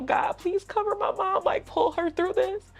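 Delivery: tearful prayer. Retold or imitated. Praying over mom.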